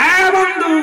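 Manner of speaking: sudden high, loud hail; calling out. Real, not acted. Preacher hails his listeners as friend, high shout.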